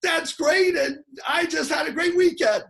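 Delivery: high, loud, gushing with a cry in it; squealing over-the-top excitement; overexcited. Retold or imitated. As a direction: burst out with excitement. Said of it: "and you're like".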